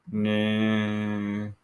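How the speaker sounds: ordinary talk, nothing marked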